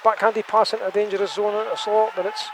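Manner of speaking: quick live hockey call; play-by-play. Acted. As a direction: call it as it happens.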